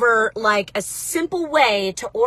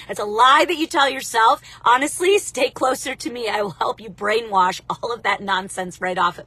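ordinary talk, nothing marked

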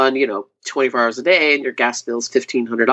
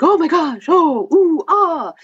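high, breathless oohs and ahs of wonder; awed visitors; 13 semitones higher, slower than usual. Retold or imitated. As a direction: gasp at it like people seeing glass pumpkins. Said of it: "they were like".

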